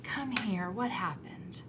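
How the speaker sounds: slow, soft and tender, fading to a hush; slow comforting voice sinking into a whisper; soothing empathy. Retold or imitated. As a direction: soothe an angry child gently. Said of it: "And so I say".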